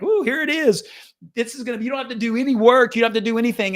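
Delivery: hammed-up wide-eyed promise; mock hype. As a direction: gush like a magic-bullet pitch. Mocks a magic-bullet sales promise.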